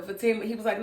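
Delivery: ordinary talk, nothing marked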